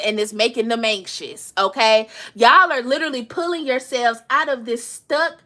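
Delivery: ordinary talk, nothing marked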